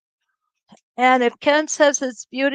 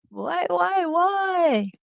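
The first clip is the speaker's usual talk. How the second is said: higher, whiny pleading whys; mock whine; 6 semitones higher, 4 dB quieter, much slower than usual. Real, not acted. Host to chat as camera fails, mock whining high whys.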